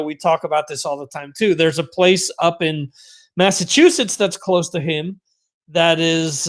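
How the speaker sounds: ordinary talk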